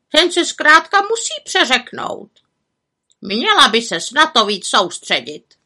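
high-pitched raven lecture; croaking raven. A narrator's reading. Raven telling the witch to concentrate, scolding croak. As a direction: lecture her like a fussy bird. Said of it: "krákal havran Abraxas".

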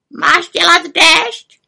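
high, loud raven's reproach; reproachful raven croak. A narrator's reading. Raven scolding the little witch, reproachful croak. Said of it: "krákal vyčítavě".

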